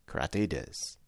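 very soft, low aside; hushed captive's reply; covert answer. A narrator's reading. Captive's covert answer to secret question.

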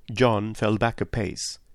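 usual speaking voice

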